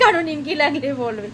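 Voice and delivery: ordinary delivery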